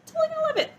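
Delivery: high, mock-breezy confidence; mock confident quote. Reader mocks her past hopes for Circe, high and breezy. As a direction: parody her own naive certainty. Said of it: "I was just like".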